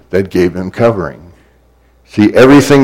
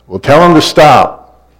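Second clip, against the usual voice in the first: higher-pitched parental order; mother's raised-voice command; mom calling out; 7 semitones higher than usual. Acted. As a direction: order the kids from another room. Mom calling back to the kids.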